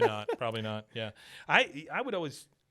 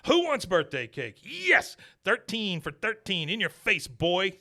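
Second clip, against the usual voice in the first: higher, louder triumphant crow; hammed-up gloating Peggy; 6 semitones higher, 5 dB louder than usual. Retelling Peggy gloating over her son, hammed-up crowing. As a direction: crow it like a sore winner.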